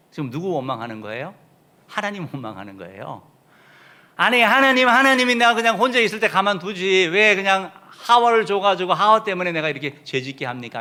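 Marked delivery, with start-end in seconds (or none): 4.1-10.8 high, loud indignant protest to God; Adam loudly blaming God for giving him Eve; blaming complaint. Acted. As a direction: whine and accuse God loudly like a caught man. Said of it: "하면서 하나님 탓하는 거예요".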